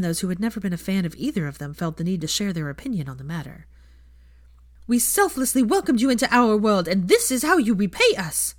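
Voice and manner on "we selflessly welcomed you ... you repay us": higher, louder indignant scolding; an offended fan's howler; bitter reproach. Acted. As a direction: scold her for ingratitude.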